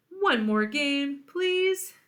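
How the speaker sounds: high, slow begging plea; the vulture begging her friend to keep playing, pleading.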